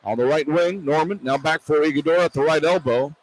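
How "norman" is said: ordinary delivery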